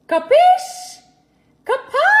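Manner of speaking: cartoonish high yelp of "Capiche?"; squeaky capiche. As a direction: push "Capiche?" up into a loud squeak. Playful check viewers understood.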